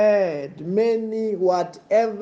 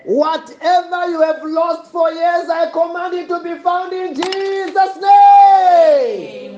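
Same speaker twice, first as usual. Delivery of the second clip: fiery preaching; 10 semitones higher, 7 dB louder than usual. Acted. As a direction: roar the blessing over the crowd.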